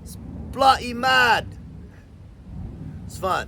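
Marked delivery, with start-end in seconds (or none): 0.0-1.5 high-pitched imitation of an English soldier; incredulous British squaddie, hammed up; mock English accent.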